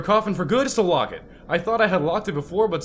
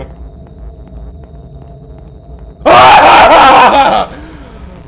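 loud, high, long laughing shout; 4 semitones higher, 12 dB louder than usual. Real, not acted. Streamer laughing off the fright, long loud laugh. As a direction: laugh it all out.

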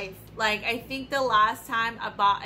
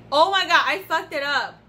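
higher and louder, real shock at a mistake; loud startled oh my god; loud dismay; 5 semitones higher, 8 dB louder than usual. Real, not acted. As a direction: blurt it out as it goes wrong. Reacting to botched spray.

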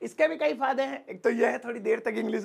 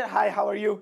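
rushed; much slower than usual. Shown as a demonstration. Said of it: "अ, नहीं भैया".

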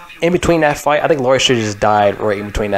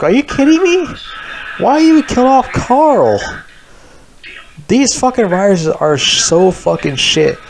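pitched-up furious rant about the writers; exasperated outburst; 10 semitones higher than usual. Real, not acted. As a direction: shriek your disbelief at the writers. Outraged rant about killing Carl.

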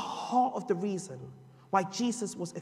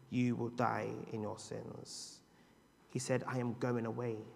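low and soft, slowed; solemn hush; 7 semitones lower, 6 dB quieter than usual. Acted. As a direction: let the warning sink in quietly. Solemn quiet reading.

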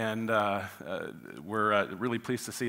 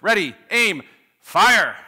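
loud, higher firing-squad commands; executioner's shout; 5 semitones higher, 10 dB louder, slower than usual. Acted. Preacher voicing executioner to firing squad as joke punchline, shouted. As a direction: shout each order, the last one loudest. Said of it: "executioner shouts".

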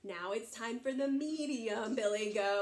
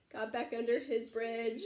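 plain reading voice

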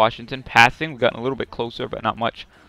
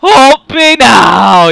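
high, loud, drawn-out plea; high, stretched, loud urging; excited shout; 9 semitones higher, 9 dB louder, much slower than usual. Real, not acted. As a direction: yell for the goalie to make it. Shootout save needed.

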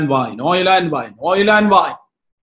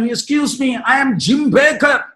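raised, slow self-introduction; man introducing himself; 9 semitones higher, 3 dB louder, much slower than usual. Retold or imitated. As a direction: call the name out, pitched high. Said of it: "തന്റെ പേര് പറഞ്ഞു".

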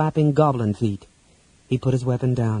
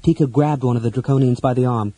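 ordinary delivery, nothing marked

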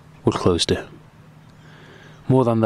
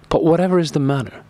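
higher, sincerely puzzled surprise; comical surprise; 9 semitones higher than usual. A narrator's reading. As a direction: ask it with wide-eyed, half-comic wonder. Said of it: "in a tone of comical surprise and sincerity".

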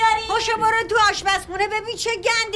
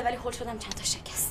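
whispered, near tears, much softer; tearful whisper; 8 semitones lower, 12 dB quieter than usual. Acted. Niece admits to her aunt that glasses broke, tearful whisper. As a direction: confess it in a whimpering whisper.

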